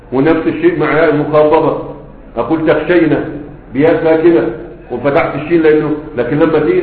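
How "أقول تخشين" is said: ordinary delivery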